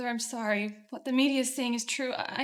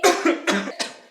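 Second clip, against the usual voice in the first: harsh coughing fit; 2 semitones higher, 8 dB louder than usual. Acted. Father collapses coughing.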